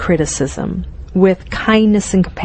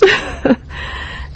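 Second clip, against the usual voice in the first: high airy giggle; 10 semitones higher, 5 dB quieter than usual. Real, not acted. Laughs at own cute demo.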